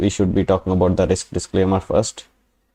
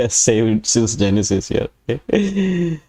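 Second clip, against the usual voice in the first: chuckling through the words; teasing with a laugh, holding back. Real, not acted.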